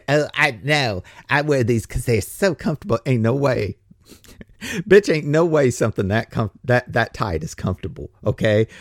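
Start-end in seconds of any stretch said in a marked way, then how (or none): 0.1-3.0 lightly voiced woman, insisting on comfort; a hint of a fibbing woman; mock voice of a woman defending her pants; mock woman's excuse. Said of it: "You know, women lie".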